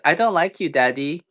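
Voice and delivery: high-pitched mock little daughter; imitating his daughter. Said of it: "My, my daughter said today".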